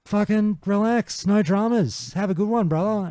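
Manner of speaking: high, slow no-worries bloke; passing mage shrugging off thanks, slow and high; laid-back mate. Retold or imitated. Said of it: "And he goes".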